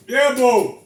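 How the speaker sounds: loud, high call across the house; yelling back to his wife who called. Real, not acted. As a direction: shout toward the other room. Answering his wife's call.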